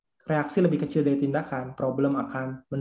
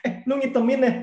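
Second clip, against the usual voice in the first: high-pitched jab with a laugh; mock put-down; 8 semitones higher than usual. Fault-finder greeting a friend about skin tone, laughing mock put-down. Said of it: "Langsung kayak nurunin kita".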